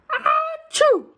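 big, high, loud pretend sneeze; hammed-up sneeze for the kids; mock sneeze. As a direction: blast out a cartoon achoo. Sneezing to blow bully down.

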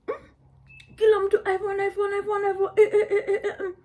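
high, loud sing-song iPhone chant; wide-eyed chant of iPhone, iPhone; mock amazement. Newcomer marvels that everyone has an iPhone, high mock chant.

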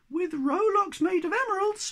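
very high, hopeful little voice; high-pitched little creature asking; squeaky Sniff. Acted. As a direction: squeak the question eagerly.

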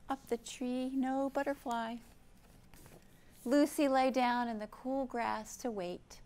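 usual speaking voice

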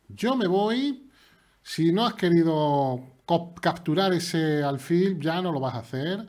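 high, loud, slow gloating; mock taunt. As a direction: taunt the opponent theatrically. Provoca al rival en broma. (burla triunfal exagerada)

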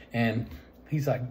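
normal talking voice

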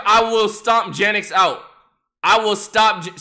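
loud, pumped-up declaration; hype shout. Pretend. Streamer yelling at chat about beating Janix, pumped shout. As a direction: roar it like a fight promo.

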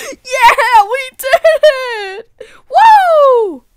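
high, loud whooping celebration; triumphant cheer. Acted. House defeated by friends, celebrating loudly. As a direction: cheer with a laugh into a big whoop.

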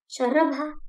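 plain reading voice

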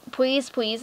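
PLEASE with W in place of L; cutesy pwease. Shown as a demonstration. Telling viewers what to type, PLEASE said as cutesy "pwease". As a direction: say PLEASE as "pweeze". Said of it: "and then P W E A S E".

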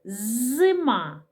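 "зима" with a long held З at the start; slow "зима", lingering on the buzzing З; sounding out. Shown as a demonstration. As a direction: draw out the first sound of "зима", З. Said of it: "Вимовляємо і визначаємо. Перший звук".

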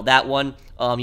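normal talking voice

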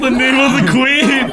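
high, mock-royal proclamation; mimicked proclamation. Host echoing the clip's officer, mimicked proclamation. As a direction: imitate a pompous cry from the clip.

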